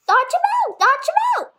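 high, honking goose voice; goose crying out. A narrator's reading. Goose shouting orders at Wilbur, high and honking. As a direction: squawk it like a bossy goose. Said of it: "cried the goose".

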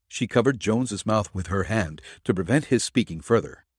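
plain reading voice

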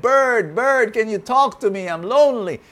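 high, loud pleading horse voice; horse calling out. A narrator's reading. Lonely horse calls loudly to the bird. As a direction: call to the bird, lonely and eager.

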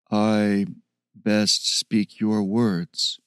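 slow, low, halting ape-man. Acted. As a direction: speak slowly like a gentle beast.

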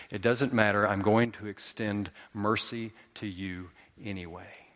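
low, softening promise of mercy; gentle, hushed resolve; tender assurance. Acted. Jesus offers mercy to the selfish.

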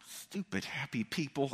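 mock-sour mumble about happy people; muttered grumble. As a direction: mutter it to yourself in jest. Grumbling about cheerful roommate.

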